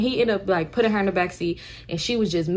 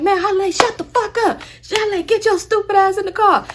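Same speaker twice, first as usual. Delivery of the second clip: angry yell; 12 semitones higher, 4 dB louder than usual. Retold or imitated. Nephew yells at aunt.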